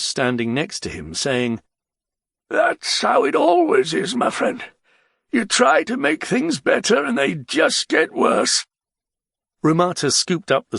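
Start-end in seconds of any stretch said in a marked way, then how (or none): 2.5-8.7 high-pitched character voice, resigned; high, put-on voice, philosophizing; resigned old friend. A narrator's reading. Voice of Father Kabani.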